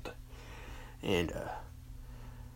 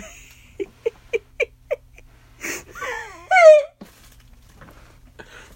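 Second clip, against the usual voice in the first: a burst of high, loud laughter; a loud, high laughing fit; loud high cackle; 14 dB louder than usual. Acted. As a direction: crack up hard. Cracking up.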